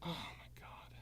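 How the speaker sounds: whispered, drained disbelief; a whispered aside to yourself; exasperated whisper. Real, not acted. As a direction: breathe it out, spent. Exasperated after the outburst.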